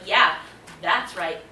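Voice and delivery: loud defiant quitting speech; defiant telling off. Retold or imitated. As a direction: tell the boss off, proud. Retold telling off boss.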